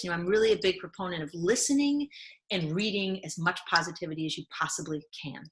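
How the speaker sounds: normal talking voice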